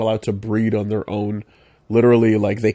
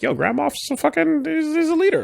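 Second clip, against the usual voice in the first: high excited voice. Real, not acted. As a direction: gush about him, pitched way up. Excited praise of Grand Moff.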